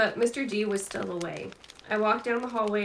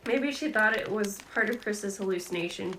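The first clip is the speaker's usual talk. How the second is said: plain reading voice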